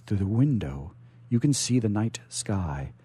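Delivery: usual speaking voice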